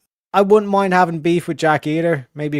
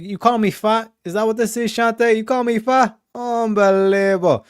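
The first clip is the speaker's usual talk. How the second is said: mock offended; 6 semitones higher than usual. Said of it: "I was like".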